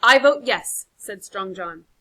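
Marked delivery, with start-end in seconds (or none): 0.0-0.9 loud vote. Acted. Casting vote in council.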